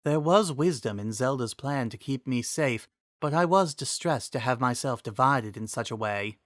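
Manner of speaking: a wise spirit speaking kindly. Acted. Triforce voiced in story.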